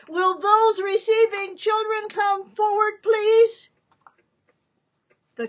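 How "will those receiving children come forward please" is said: high, loud announcement to a crowd; the nun asks adopting families to come forward; nun calling out. Acted. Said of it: "Sister Ursula call".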